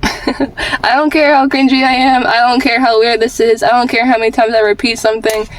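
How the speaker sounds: ordinary delivery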